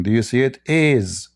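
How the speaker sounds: ordinary talk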